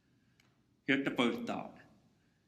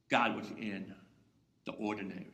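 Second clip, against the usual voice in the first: hushed; 6 semitones lower, 5 dB quieter than usual. Acted. Answer dropped to a hush.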